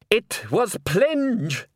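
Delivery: high, drawn-out, emphatic announcement; triumphant accusation. A narrator's reading. Salzella naming the culprit to the crowd, triumphant. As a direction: declare it slowly and triumphantly, pitched high.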